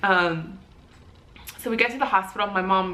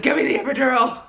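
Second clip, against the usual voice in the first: higher, louder insistent outburst; mock desperate demand; 5 semitones higher, 5 dB louder, faster than usual. Labouring woman interrupts the nurse, mock desperate. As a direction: cut in, pitched up and forceful.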